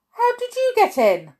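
high, loud gasp of astonishment. A narrator's reading. Mister Mean finding the wizard in his kitchen, gasped. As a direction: gasp it out, startled and pitched right up. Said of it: "gasped Mister Mean".

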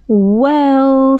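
long, drawn-out unsure "well", pitched high. A narrator's reading. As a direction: stretch it out while unsure what to say. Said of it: "not quite sure what it was all about".

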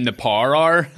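put-on "Power Hour" squashed to "par ar" in Belfast accent; teasing Belfast take on "Power Hour". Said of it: "If you're from Belfast".

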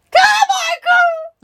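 very high, drawn-out shout; hammed-up howl of the name; loud cry. A narrator's reading. Indian gentleman cries out to Carmichael, long high shout. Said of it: "cried out loudly".

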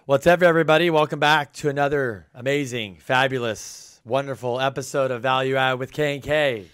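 hyped, loud host welcome; big energetic show opener; excited. Real, not acted.

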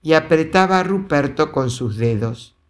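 ordinary delivery, nothing marked

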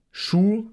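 "Schuh" with a plain long u; Upper Bavarian form. Shown as a demonstration. Teacher showing how Upper Bavarians talk, plain oo in "Schuh". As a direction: say "Schuh" with a pure oo vowel. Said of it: "Während der Oberbayer".

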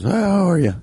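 reply in the odd customer's put-on voice; weird customer voice.

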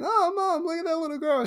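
put-on high, childlike voice of an excited little girl; a kid tugging at her mom, pointing up. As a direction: squeal it like a small kid pointing at a balloon. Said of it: "that little girl's like".

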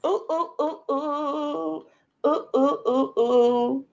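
high, soft "ooh" hoots; high hooting. Real, not acted. Co-host answering the show intro, high playful hooting. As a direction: hoot a row of high oohs.

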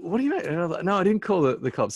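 high-pitched, baffled denial; retold startled protest. Telling the thief no cops were called, startled protest. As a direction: protest in disbelief, pitch jumping up.

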